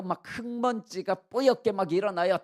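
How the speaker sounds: ordinary talk, nothing marked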